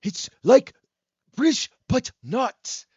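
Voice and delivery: high, loud British-American put-on voice; Yeti-like comic character voice; Connor. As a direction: hunt for words in a cartoonish accent. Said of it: "I'm trying to do Connor's voice".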